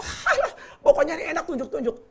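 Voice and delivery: seruan tinggi meremehkan di awal; acuh tak acuh. Acted. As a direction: lagak orang kaya yang cuek. Memerankan orang kaya memesan makanan. (nada cuek orang kaya, melengking di "Alah")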